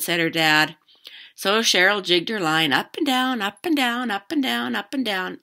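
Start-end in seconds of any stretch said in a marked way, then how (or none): none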